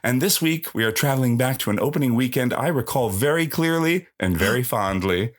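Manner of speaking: upbeat host welcome; podcast intro. Acted. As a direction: open the show brightly.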